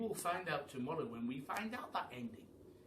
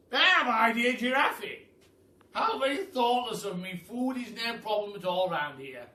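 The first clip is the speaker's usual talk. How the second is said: loud, grand aristocratic Duke, higher; booming Duke; 8 semitones higher, 11 dB louder than usual. Acted. The Duke welcomes the hungry giraffe, booming and grand. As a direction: boom it out like a jolly grand duke.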